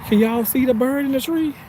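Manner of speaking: ordinary delivery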